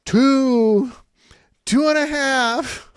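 high, loud, drawn-out mock counting; parent counting warning. Parents counting at a stalling kid, mock high loud count. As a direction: imitate an exasperated parent stretching out each count. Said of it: "Parents start counting".

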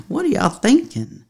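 put-on a saint scolding modern couples in a Texas accent. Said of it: "he'd speak with a Texas accent".

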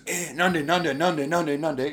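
high-pitched, rapid-fire repeated protest; agitated protest. Retold or imitated. Acting out the man's protest.

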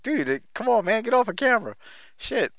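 high, pleading get off camera; exasperated. Retold or imitated.

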